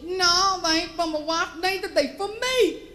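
high, insistent country twang; imitating a frantic South Georgia farmer; panicked farmer. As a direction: protest urgently like a flustered good old boy. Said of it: "was in a little bit of a panic".

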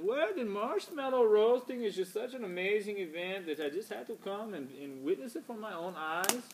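put-on high-pitched, unplaceable foreign accent; Marcio. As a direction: play a co-host with a vague foreign accent. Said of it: "didn't know where his accent's from".

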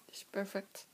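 usual speaking voice

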